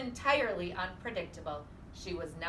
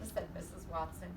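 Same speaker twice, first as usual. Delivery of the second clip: ordinary delivery, nothing marked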